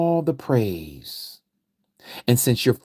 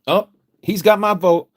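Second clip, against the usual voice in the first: much higher, louder gushing voter; mock eager voter; 10 semitones higher, 5 dB louder than usual. Preacher mocking voters won over by faith talk, high and loud. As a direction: gush like a fooled voter. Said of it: "Obama said".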